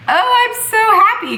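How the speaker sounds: high, teary happy whimper; play-cry tears of joy. Retold or imitated. Pregnant vlogger imitating her own joyful crying, high and teary.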